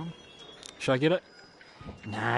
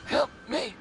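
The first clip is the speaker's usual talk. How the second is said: panicked cry; 12 semitones higher than usual. Real, not acted. Panicking in horror game.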